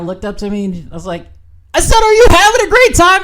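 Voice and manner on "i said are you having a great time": very high, loud, insistent shout; louder shouted repeat. Retold or imitated. Repeating himself to a woman who didn't hear, shouted. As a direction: repeat it as if she couldn't hear you. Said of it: "In case she didn't hear me".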